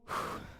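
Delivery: long breath out; weary sigh. Real, not acted. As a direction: let out a soft, tired sigh. Host after a long call.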